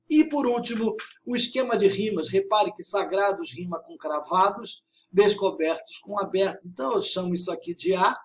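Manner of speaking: ordinary talk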